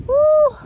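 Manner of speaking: loud high whoop. Real, not acted. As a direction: let out a big whoop. Triumphant whoop while harvesting.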